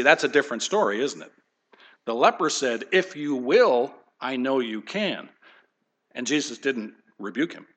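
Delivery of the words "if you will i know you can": slow plea. Retold or imitated. Preacher retells the leper's plea.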